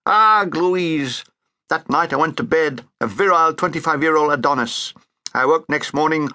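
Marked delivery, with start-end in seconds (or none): 0.1-1.2 lovesick groan over the name; a yearning groan; groaning sigh. Acted. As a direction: moan the name longingly. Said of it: "I groaned".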